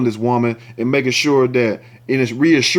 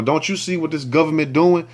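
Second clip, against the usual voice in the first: usual speaking voice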